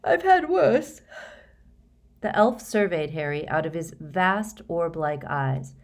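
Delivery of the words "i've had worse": high, stretched yawn-talk; sleepy words lifted by a yawn. A narrator's reading. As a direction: say it through a yawn. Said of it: "yawning and rubbing his eyes".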